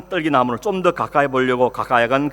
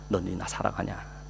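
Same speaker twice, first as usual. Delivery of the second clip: hushed question; 6 semitones lower, 10 dB quieter than usual. Acted.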